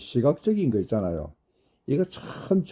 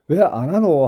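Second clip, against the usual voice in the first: a touch of accusing "왜 안 하노?", louder; mock challenging tone; 4 semitones higher, 5 dB louder than usual. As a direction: demand why, lightly mocked. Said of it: "하고 따지는 게 아닙니다". ("왜 안 하노?"를 따지듯 살짝 흉내)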